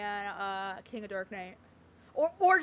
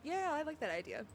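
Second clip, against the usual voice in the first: ordinary talk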